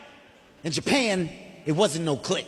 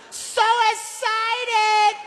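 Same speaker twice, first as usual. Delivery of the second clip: very high and drawn out, much slower; high slow mock Japanese voice; 14 semitones higher, 4 dB louder, much slower than usual. To the narrator on the ride, very high slow "So excited". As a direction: stretch "So excited" high and slow like an overeager stranger. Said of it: "What'd you just say?".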